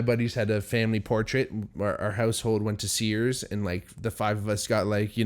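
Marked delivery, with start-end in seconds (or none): none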